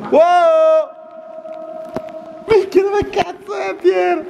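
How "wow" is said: normal talking voice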